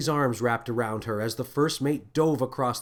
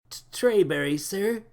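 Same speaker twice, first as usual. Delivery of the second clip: higher, nervous stutter; stammering; 7 semitones higher than usual. A narrator's reading. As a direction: stumble over your own name. Stammering crewman.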